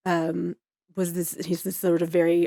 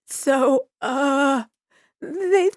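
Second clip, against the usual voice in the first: high, halting, acted nervous young doctor; trembling; 10 semitones higher, 5 dB louder than usual. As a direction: break bad news as a nervous rookie, voice rising into a question. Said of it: "she's already trembling".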